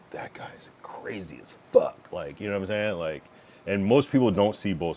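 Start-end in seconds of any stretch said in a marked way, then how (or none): 0.1-2.1 slow hushed whisper; whispered quote. Retold or imitated. People gossiping about him, slow whisper. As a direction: whisper it like gossip behind his back. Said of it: "fifteen to ten percent that are like".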